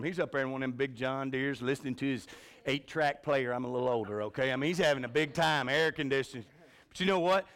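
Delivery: ordinary talk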